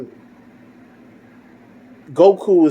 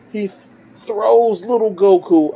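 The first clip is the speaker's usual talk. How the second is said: ordinary talk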